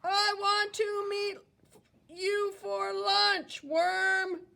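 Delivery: high, sweet, scheming bird; sly bluebird voice. Acted. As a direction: coax the worm high and sugary. Bluebird again.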